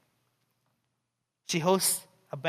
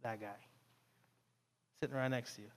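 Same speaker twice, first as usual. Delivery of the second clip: much softer, lower pointed accusation; quiet lower voice naming the culprit; hushed accusation; 6 semitones lower, 12 dB quieter, faster than usual. Retold or imitated. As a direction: point him out under your breath. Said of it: "She's like".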